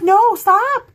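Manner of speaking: high, loud plea to stop; yelling no. Retold or imitated. Fan begging the fighting boys to stop, loud and high. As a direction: cry out to make the fight end.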